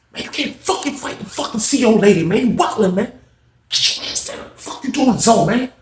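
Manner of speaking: high, hushed remonstration; acted urgent warning. Friend warning inmate off fighting the officer, urgent. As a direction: urge him down without being overheard.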